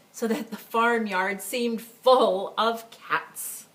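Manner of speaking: ordinary delivery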